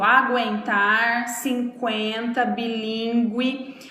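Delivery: slow "aguentar, cinquenta, bilíngue", with the u sounded after g and q; u pronounced after g/q. Shown as a demonstration. Teacher showing that pronunciation stays without the trema, u sounded. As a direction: draw out "aguentar, cinquenta, bilíngue", voicing each u as a w glide. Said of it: "a pronúncia, como eu disse, continua a mesma".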